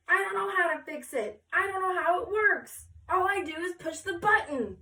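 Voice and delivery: high, loud helpless panic; panicked. Acted. Photographer admitting to the class he cannot fix it, loud panic. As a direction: plead in high loud distress.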